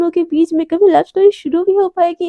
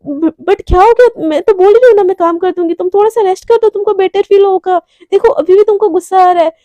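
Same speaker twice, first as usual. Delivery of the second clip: higher, louder protest; baffled pleading; 5 semitones higher, 5 dB louder than usual. Acted. Neha urging angry Devika to rest, baffled. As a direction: plead in a raised, puzzled voice.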